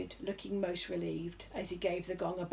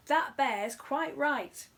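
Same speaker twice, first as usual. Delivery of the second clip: slow, higher, emphatic call; heckler; 6 semitones higher, 5 dB louder, much slower than usual. A narrator's reading. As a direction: back the bear up loudly. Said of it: "cried a voice".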